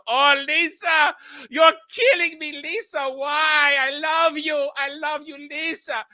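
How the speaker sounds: hammed-up high, anguished moaning; melodramatic lovesick wail. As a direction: wail it like an overacting heartbroken lover. Acting out John's overwrought love lament.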